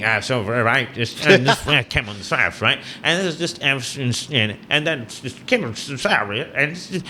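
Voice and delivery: mumbled mock Irish accent; imitating a comedian's thick Irish accent. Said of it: "because he had so thick accent, he was just going".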